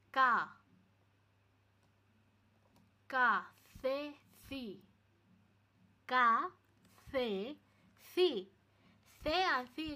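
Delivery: ka, se, si called out loud and clearly; loud pronunciation drill. Shown as a demonstration. Teacher drilling students on the Spanish syllables, loudly. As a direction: belt each syllable like a drill for the class. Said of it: "ই দিয়ে হলি সি".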